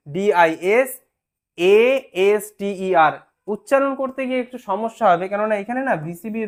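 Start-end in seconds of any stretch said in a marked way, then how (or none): 0.1-3.1 ডিআইএসএএসটিইআর spelled letter by letter very slowly; slow spelling out. Shown as a demonstration. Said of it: "এইরকম ভাবে disaster শব্দটা লেখা হয়". (ডিআইএসএএসটিইআর অক্ষর ধরে ধরে খুব ধীরে বানান)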